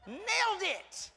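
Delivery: high, slow, loud victory shout; drawn-out triumphant yell of nailed it. Real, not acted. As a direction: crow it like a champion. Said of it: "I felt like I nailed it".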